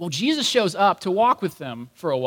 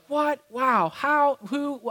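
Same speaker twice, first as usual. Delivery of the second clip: amazed exclamations; 8 semitones higher, much slower than usual. Retold or imitated. Disciples amazed at each revelation.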